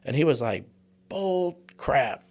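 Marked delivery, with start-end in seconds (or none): 1.1-2.2 scoffing father, much higher pitched; angry father. Retold or imitated. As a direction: spit out a mock angry dismissal. Dad about to punch son.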